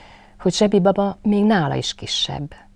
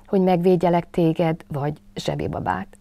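bravely hesitant; 4 semitones higher, 4 dB louder than usual. A narrator's reading. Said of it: "tette hozzá bátran".

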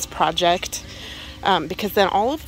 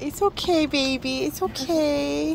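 soothing a scared animal; 5 semitones higher, 5 dB quieter than usual. Real, not acted. Soothing a handled snake.

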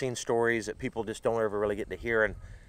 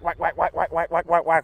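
higher, louder string of whacks; imitated rapid casting; 5 semitones higher, 4 dB louder than usual. Telling friends how he fished all round the laydowns, excited sound-effect whacks. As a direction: fire off the whacks like repeated casts.

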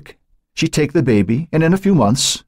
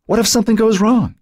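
very high, frightened plea; begged; 3 dB louder than usual. Acted. Joan begging her sister in labor, frightened and pleading. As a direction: beg her to let you call for help. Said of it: "Joan begged".

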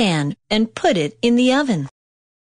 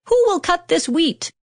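high, eager hen's question; hen crying out; 10 semitones higher than usual. A narrator's reading. Hen asking the lazy animals for help, high call. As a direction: call out brightly as the little hen. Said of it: "the little red hen asked".

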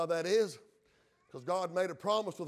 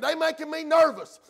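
higher, agitated preacher's impression of Pharaoh; nervous Pharaoh; 8 semitones higher, 7 dB louder than usual. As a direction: play up Pharaoh's nerves, voice pushed up. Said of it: "he got a little bit nervous".

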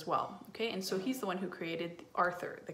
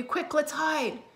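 alarmed; 8 semitones higher, 4 dB louder, slower than usual. Acted. D W wants to flee the twins.

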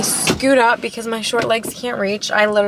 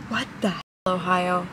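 ordinary delivery

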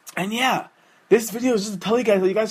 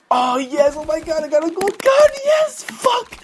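mock triumphant yelling; 10 semitones higher than usual. Viewer acting out finding the gold gun, hammed-up shrieking joy. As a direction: squeal like you just won the jackpot.

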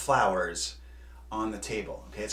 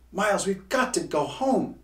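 higher, louder urgent call; shouting; 7 semitones higher, 5 dB louder than usual. A narrator's reading. Hugo shouting to Miles to head home. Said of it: "shouted Hugo".

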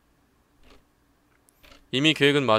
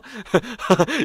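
short laugh; 8 semitones higher, 4 dB louder than usual. Real, not acted. Laughs at scene.

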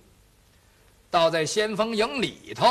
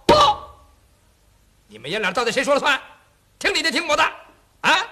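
impatient mockery; 2 semitones higher, 7 dB louder, much faster than usual. 元帅不耐烦质问.

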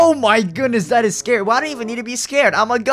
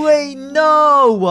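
higher, louder cry of dismay; 7 semitones higher, 6 dB louder than usual. Acted. Gamer whose PC just got switched off, dismayed cry. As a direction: protest in alarm as it all vanishes.

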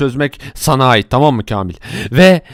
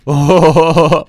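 ordinary talk

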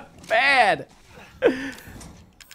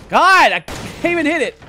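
loud, higher, slow frustrated groan of words; 9 semitones higher, 8 dB louder than usual. Real, not acted. Gamer fuming at missing his shots, loud. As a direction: grit out the annoyance.

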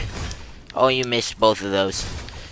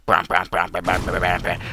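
mouth beat; 4 semitones lower than usual. Acted. Dance beatbox.

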